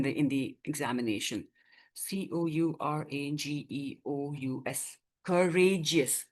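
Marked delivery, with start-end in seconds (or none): 2.0-5.0 letter by letter, low and soft; spelling aloud. Shown as a demonstration. Teacher spells out a word. 5.2-6.2 "Courageous" slowly in distinct syllables. Shown as a demonstration. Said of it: "Rather, we pronounce this as".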